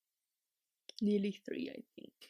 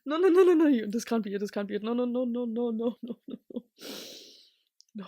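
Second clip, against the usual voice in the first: 1 mock despair; 9 dB louder, faster than usual. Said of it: "I was like".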